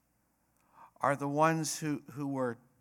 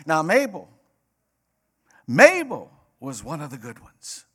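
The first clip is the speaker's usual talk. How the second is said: imitated southern hellfire preacher; mock southern pulpit delivery, bursting louder; southern accent; 6 semitones higher, 10 dB louder, faster than usual. Southern pastor preaching at great-aunt's funeral, imitated southern accent. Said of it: "He said in a somewhat southern accent".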